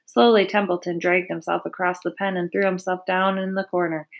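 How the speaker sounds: ordinary delivery, nothing marked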